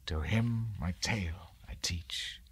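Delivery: soft ominous whisper. A narrator's reading. Mariner whispering to the wedding guest. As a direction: lean in and whisper it.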